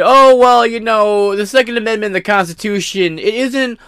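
high, slow sneering mock voice; snide mock of the court; mocking imitation. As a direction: ham up a smug judge. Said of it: "flagrantly say".

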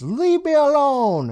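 very high, drawn-out cry; mock scream. Teller acts Julie screaming at bullies on the phone, high mock scream. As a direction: scream it slowly in a high put-on voice. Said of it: "She screams".

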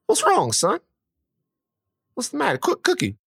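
high-pitched father questioning his son; concerned dad. Retold or imitated. Dad asks his son what's wrong, high and concerned. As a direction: imitate a puzzled dad calling for backup. Dad worried, calls to mom.

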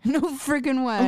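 can't believe it, laugh through it; giggly no frickin' way; laughing disbelief. Real, not acted.

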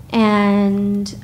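normal talking voice

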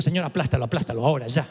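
low, mock exasperated plea to God; mock fed-up Moses. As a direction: play Moses at the end of his rope, dropping low. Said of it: "yo que Moisés digo".

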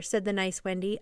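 plain reading voice

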